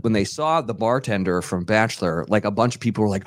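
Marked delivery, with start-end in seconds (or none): none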